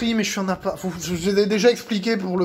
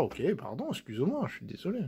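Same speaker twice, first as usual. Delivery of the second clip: much softer, murmured sorry to the cat; soft apology; 4 semitones lower, 14 dB quieter, faster than usual. Real, not acted. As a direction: hush it down like soothing a pet.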